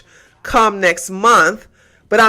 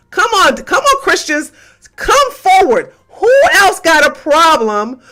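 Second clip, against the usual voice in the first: high, loud call to come forward; shouted challenge; 6 semitones higher, 3 dB louder than usual. Real, not acted. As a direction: dare them to step up. Challenging the Christians.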